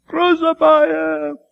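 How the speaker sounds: high, loud drawn-out scream; slow shouted cry; screaming crowd. A narrator's reading. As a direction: scream it like the mob. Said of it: "many of them are screaming".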